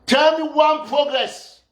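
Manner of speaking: "Tell" hit, whole line slowed; slow and pointed "Tell me one progress"; slow insistent challenge. Real, not acted. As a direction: spell it out slowly, daring him. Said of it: "repeated challenge".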